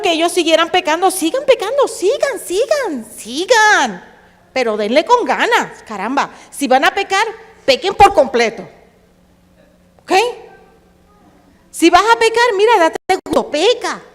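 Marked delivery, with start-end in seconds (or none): 1.1-4.0 sarcastic prophet. Retold or imitated. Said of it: "Amós también habló con sarcasmo". 4.5-14.0 sarcastic urging. Retold or imitated. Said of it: "Amós también habló con sarcasmo".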